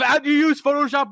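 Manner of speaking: high, loud eager son; eager kid voice. Retold or imitated. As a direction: ask it like a keen young YouTuber kid. Son asking dad, voiced.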